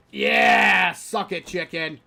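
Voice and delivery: loud gloating yell; triumphant shout. Real, not acted. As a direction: crow over the kill. Kills chicken, gloating.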